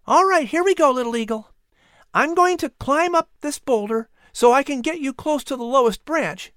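put-on high-pitched, encouraging boy's voice; explaining boy. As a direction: explain the plan kindly in a high voice. Boy explains climb to eagle.

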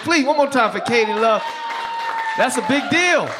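loud call for applause; hyping the crowd. Real, not acted. Comic rallying the crowd to cheer for Katie, loud hype. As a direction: pump the room up for the host.

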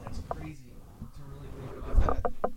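barely voiced, amazed; whispering. Real, not acted. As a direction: breathe it under your breath.

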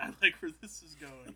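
pleased surprise on "like"; delighted Palpatine. Retold or imitated. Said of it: "he's like".

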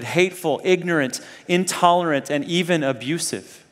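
ordinary talk, nothing marked

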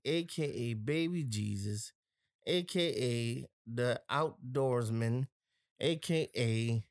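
slow, drawn-out aka list; hype-man roll call. Acted. Host gives a listener a shout out, announcing each nickname.

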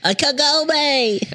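very high, long drawn-out name; stretched falsetto imitation; mock screechy call. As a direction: parody a screeching anime character calling out. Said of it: "Why is she screeching?".

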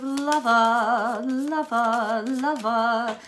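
slow, stretched-out crooning; playful drawl. Real, not acted. Reader relishing news of a romance, playful drawn-out repeats. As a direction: draw each word out teasingly.

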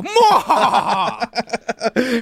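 slow hammy evil cackle; evil villain cackle. Retold or imitated. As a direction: drag out a cartoon villain laugh. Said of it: "he was basically mustache twirling".

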